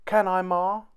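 high-pitched hopeful question; small boy begging permission; eager pleading. A narrator's reading. Tom pleads with mother.

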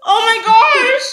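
gasping from spicy heat; burning mouth, loud and high; mouth on fire. Real, not acted. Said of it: "My whole mouth is on fire!".

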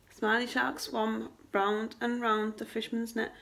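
plain reading voice

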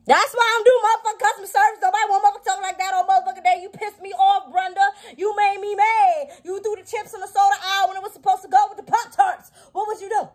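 fast, high, loud tirade; mock angry rant. Ranting at Brenda over misplaced chips, mock furious. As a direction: blow up at a co-worker, high and rapid.

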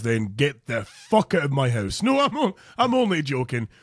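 usual speaking voice

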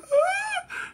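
very high-pitched mock wail; imitated hard crying. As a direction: mimic a mother's hard sobbing. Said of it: "I'm talking about them hard cry".

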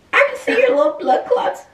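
very high, loud squeal of disgust; horrified outcry. Real, not acted. Partner seeing supposed blood on face, horrified squeal. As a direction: squeal it in revolted disbelief.

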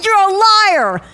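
high, loud mock yell of a child; kid yelling. Six-year-old yelling back at his mom, loud mock yell. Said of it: "he starts yelling".

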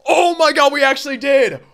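high, loud cry of triumph; excited yell. Real, not acted. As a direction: yell it like the long shot paid off. Diamond pulled from chase pack.

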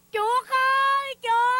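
wailing lament. Acted. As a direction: cry out at full voice for the lost child. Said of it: "con đang khóc than ủ rũ".

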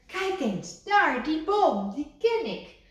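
high, loud excited call; excited cry. A narrator's reading. Kikker spotting home tree, high and loud. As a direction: point and shout with joy. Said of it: "riep Kikker".

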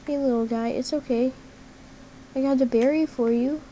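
ordinary talk

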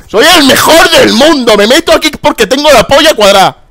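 sneering parody of bunker campers; mocking campers. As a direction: ham up a smug camper bragging. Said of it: "como diciendo".

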